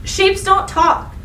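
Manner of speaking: usual speaking voice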